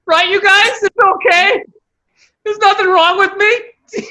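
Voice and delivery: high mock-pleading imitation; needy reassurance-seeking. Friend imitating his appeal to the guys, mock-needy. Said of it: "I love when you asked us".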